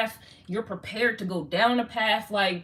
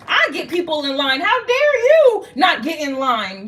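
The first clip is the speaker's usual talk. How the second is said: mock bossy leader; 6 semitones higher, 4 dB louder than usual. Voicing the bossy jealous ones.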